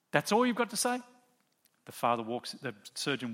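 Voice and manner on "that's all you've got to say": higher, indignant; indignant disbelief. Retold or imitated. Angry father scoffing at the surgeon's reply, indignant.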